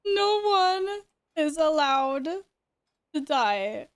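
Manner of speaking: commanding call. Real, not acted. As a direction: bark the raid rule at everyone. Raid lead ordering the group.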